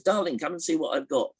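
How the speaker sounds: high-pitched call to a husband; wife calling. Acted. Woman calls her husband down, high calling voice. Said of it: "then she calls her husband down".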